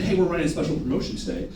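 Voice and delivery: fast, low announcement to everyone; generic promotion spiel. Shown as a demonstration. Salesman announcing a promotion to everyone, impersonal and rushed. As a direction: rattle off the store promotion. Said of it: "which one's better, first or second?".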